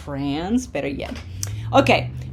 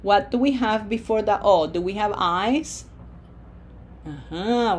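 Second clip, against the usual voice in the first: usual speaking voice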